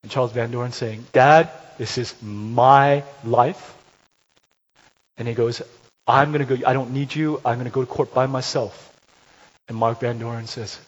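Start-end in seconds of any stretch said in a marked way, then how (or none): 1.1-3.7 loud, slow defiant protest; defiant son. Retold or imitated. Son defies father in retelling. 6.1-8.9 loud, pushing the father away; louder, insistent rejection; angry refusal. Retold or imitated. Son refuses father's help.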